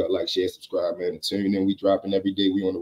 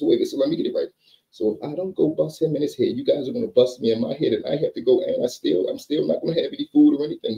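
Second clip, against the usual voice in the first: scared Billy; 7 semitones higher than usual. Retold or imitated. Billy panics high as the brothers threaten him. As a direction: pitch up and spill it out nervously.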